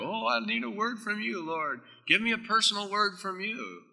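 high, whiny mock plea for a word; mock pleading believer. Mocking the people who crave a personal word, high and pleading. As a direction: mimic someone begging God for a personal word, pitched high. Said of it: "Some people do".

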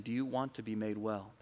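soft, quiet, tender question; Jesus asking gently. Acted. Jesus softly asking the sick man by the pool. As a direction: ask it softly, like an offered hand.

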